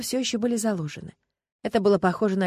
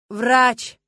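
loud, raised shout for help; one forceful, desperate cry; frantic yell; 4 semitones higher, 8 dB louder than usual. A narrator's reading. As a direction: yell for a doctor, beside yourself. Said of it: "не своим голосом заорал Стас".

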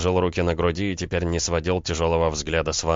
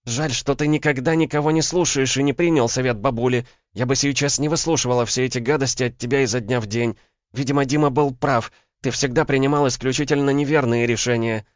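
high, heated female tirade; angry reproach; 8 semitones higher, 4 dB louder than usual. A narrator's reading. Woman blaming man for his bad decisions, angry. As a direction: keep the reproaches coming, pitched up.

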